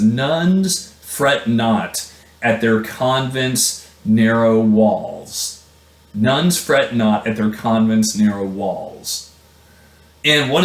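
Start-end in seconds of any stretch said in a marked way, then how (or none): 0.0-5.6 slow poetic reading. Shown as a demonstration. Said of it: "He says".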